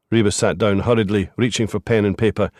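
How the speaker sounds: ordinary delivery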